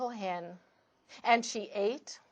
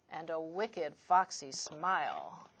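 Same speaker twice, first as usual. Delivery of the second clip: "wicked, foxy smile" dropped much lower and softer; sly hushed; 7 semitones lower, 8 dB quieter than usual. A narrator's reading. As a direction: let "wicked, foxy smile" sink to a low, soft hush. Repeated wicked foxy phrase.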